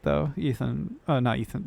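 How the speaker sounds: normal talking voice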